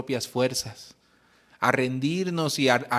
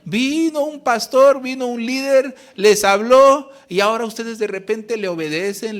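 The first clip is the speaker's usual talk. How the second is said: builds from a grumble to a loud, high-pitched rant; mother's angry outburst; 6 semitones higher, 7 dB louder than usual. Retold or imitated. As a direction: voice the mother's rage rising to a shout. Mother's outburst retold, voice climbing. (la madre, herida con los hijos, pasa de la queja a gritar con rabia)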